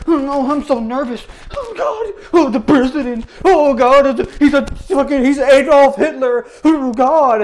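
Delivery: mock a terrified damsel in distress; mocking her fake fear of the president, high and panicky. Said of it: "I thought you were a terrified damsel in distress".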